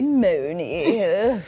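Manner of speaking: imitating the mid-Atlantic drawl; mocking transatlantic accent. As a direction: echo her posh film-star voice. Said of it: "appropriating what?".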